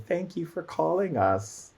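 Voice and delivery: high, soft, sing-out reply; underworld spirits. Acted. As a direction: answer softly and high as obliging spirits. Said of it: "all the spirits that support that in the underworld".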